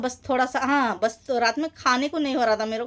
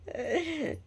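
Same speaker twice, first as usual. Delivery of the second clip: soft laugh; 2 semitones higher, 9 dB quieter than usual. Real, not acted. Embarrassed at forgetting the day.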